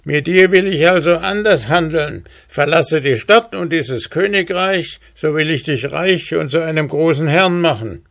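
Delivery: high-pitched dwarf voice; Zwerg. A narrator's reading. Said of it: "sprach der Zwerg". (hohe Zwergenstimme)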